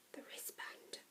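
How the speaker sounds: secretive whisper. A narrator's reading. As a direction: whisper so nobody overhears. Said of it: "I whispered".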